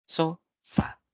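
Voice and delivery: "sofá" split into so and fá; "sofá" in two distinct beats; syllable by syllable. Shown as a demonstration. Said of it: "tiene dos sílabas".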